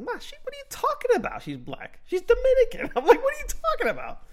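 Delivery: very high, fast disbelief; exasperated squeak. Retold or imitated. Son arguing, exasperated.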